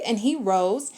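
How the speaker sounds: plain reading voice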